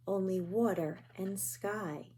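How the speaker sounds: a quiet echo as night falls; hushed fading repeat. A narrator's reading. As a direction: let it fade to almost nothing.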